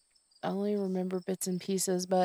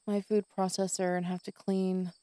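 ordinary delivery

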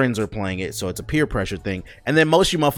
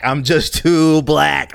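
drawn-out comic wail; 4 semitones higher, 6 dB louder, much slower than usual. Shown as a demonstration. Joking about failing the English accent, slow drawn-out wail. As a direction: drag it out for comic effect.

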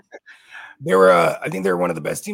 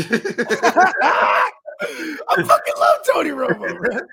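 high, loud shout through laughter; laughing outburst; 8 semitones higher, 7 dB louder, much slower than usual. Real, not acted. Cracking up after the Corona phone bit, real laughing shout. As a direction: burst out laughing as you shout it.

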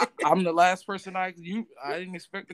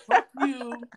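laughing quote; 5 semitones higher, much slower than usual. Retold or imitated. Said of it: "I wanna hear about the".

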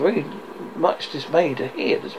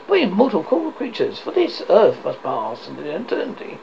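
ordinary delivery, nothing marked

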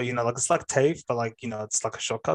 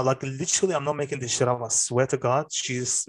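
normal talking voice

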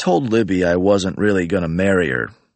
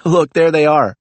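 excited call; 8 semitones higher, 5 dB louder than usual. Acted. Libby spotting the guys outside.

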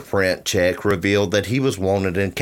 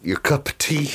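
mock British accent; 6 semitones higher than usual. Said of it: "Aren't we so British?".

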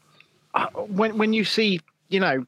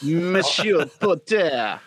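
mock French; much slower than usual.

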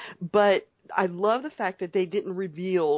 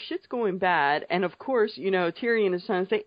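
usual speaking voice

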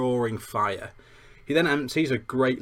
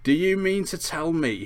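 higher, mildly indignant demand; growled; 7 semitones higher, 3 dB louder than usual. A narrator's reading. Hagrid rounding on the Dursleys, mild indignant growl. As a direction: confront them in outraged disbelief. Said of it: "he growled at the Dursleys".

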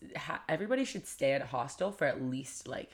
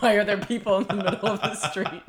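higher, louder puzzled voice; mock bewilderment; 6 semitones higher, 10 dB louder than usual. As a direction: play the baffled driver. Voicing confused drivers.

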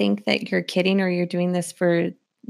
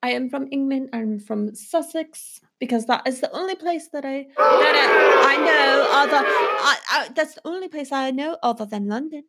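light put-on British accent, defensive; 4 semitones higher than usual. [name] defends her British character to her husband, in a posh accent. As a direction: insist on being English, in a posh put-on voice. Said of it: "taking your character a little too far".

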